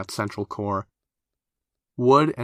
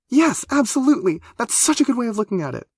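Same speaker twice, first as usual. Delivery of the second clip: high, loud, fast relieved gush; loud relief; 5 dB louder than usual. A narrator's reading. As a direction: turn the volume up with relief. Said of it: "relief was connected to the volume knob".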